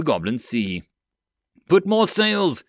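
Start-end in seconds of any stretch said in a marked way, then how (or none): 1.7-2.6 high, loud command shout; yelling. Acted. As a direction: bark the order across the deck. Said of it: "Amaj yelled".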